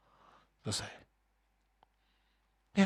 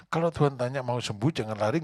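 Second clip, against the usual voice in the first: ordinary talk, nothing marked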